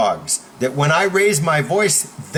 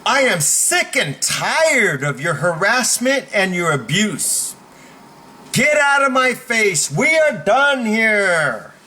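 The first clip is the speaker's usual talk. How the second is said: emphatic, building to high command; public protest; 6 semitones higher than usual. Shown as a demonstration. Coach demos standing up for everyone to hear, building protest. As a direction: declare it to everyone, rising to a stretched finish. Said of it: "this is how it's done".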